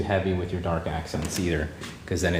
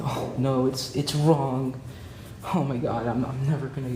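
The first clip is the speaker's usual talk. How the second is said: higher, whiny mock panic over a mistake; mock panicked self-talk; 5 semitones higher than usual. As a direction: play the anxious artist scolding herself. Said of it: "Don't get so caught up with".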